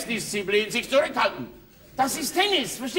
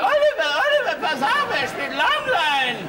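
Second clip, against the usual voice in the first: mock yell, much higher and louder, fast; shrill tennis mother; 8 semitones higher, 3 dB louder than usual. Pushy mother yelling to her son Oliver on court, mock screech. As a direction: ham up a pushy tennis mum calling out.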